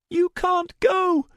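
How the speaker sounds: insist, not giving an inch; insistent high girl voice. A narrator's reading. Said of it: "Starbreeze insisted".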